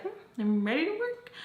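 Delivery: high, quick, chipper mock cheer; hunky-dory. Recalling how she came in to work, hunky-dory. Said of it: "I came in all hunky-dory".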